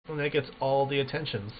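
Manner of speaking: normal talking voice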